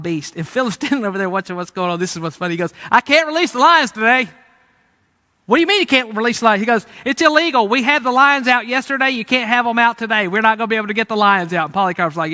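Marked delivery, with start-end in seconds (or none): 2.9-4.3 high, loud mock indignation; comic protest. Philip answering Herod's order, loud comic protest. 5.4-6.6 fast, loud, raised-pitch disbelief; incredulous outburst. Acted. Herod challenging his lion keeper, loud and incredulous. 7.0-11.7 loud, high, insistent excuse; comic protest. Acted. Lion keeper refusing Herod's order, loud exasperated protest.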